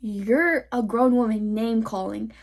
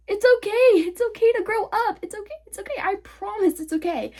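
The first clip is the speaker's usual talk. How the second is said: high-pitched, sarcastic soothing; mock consoling; 9 semitones higher than usual. Real, not acted. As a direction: coo fake reassurance in a high voice. Mock-consoling older people.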